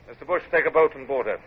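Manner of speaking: normal talking voice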